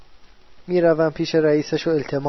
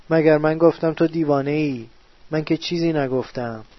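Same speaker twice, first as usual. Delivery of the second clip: normal talking voice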